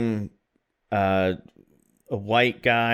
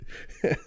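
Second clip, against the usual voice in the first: high laugh; 7 dB quieter than usual. Real, not acted. Laughing at his own story.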